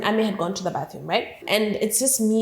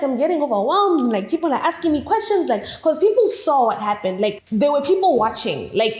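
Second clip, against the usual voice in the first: ordinary talk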